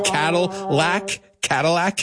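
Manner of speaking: slow, teasing question. Pretend. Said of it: "asks the class".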